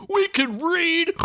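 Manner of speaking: high, slow dim-witted warthog grunt; snorting warthog. Acted. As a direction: snort the words like a dumb pig-man. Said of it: "snorted a warthog guy lackey".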